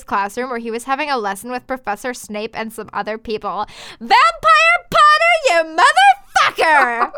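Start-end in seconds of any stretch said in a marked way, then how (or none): 4.0-7.1 very high, loud, drawn-out shout; full yell. Acted. Girl storming into a classroom to confront Vampire, hammed-up yell. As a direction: yell slowly and furiously at the top of the voice. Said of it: "I yelled".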